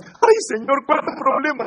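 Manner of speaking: high-pitched complaining prayer; put-on woeful plea; mock lament. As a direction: lament your troubles, play-acted. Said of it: "No es".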